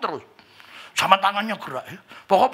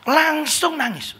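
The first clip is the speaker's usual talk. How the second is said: crying; 10 semitones higher, much slower than usual. Retold or imitated. Preacher cries in testimony.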